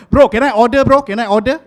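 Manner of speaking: loud impatient customer calling bro; a customer hailing the guy as bro; mock customer. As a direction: imitate someone ordering at a counter. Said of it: "call me like".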